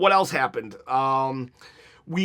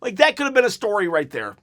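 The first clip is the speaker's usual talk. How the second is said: ordinary talk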